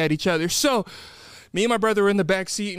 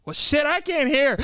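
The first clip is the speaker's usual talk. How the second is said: put-on high-pitched grumbling old man; hard-of-hearing grandpa; 9 semitones higher than usual. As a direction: complain high, as a deaf old husband. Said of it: "Vernon can't hear very well".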